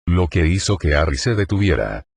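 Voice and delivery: ordinary delivery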